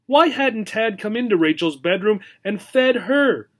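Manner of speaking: normal talking voice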